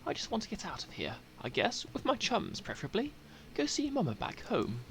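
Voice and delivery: high-pitched character voice, lilting up and down; Ruki Greentail. Acted. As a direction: pitch it up for a chummy exile missing Mama. Voices Ruki introducing himself in game.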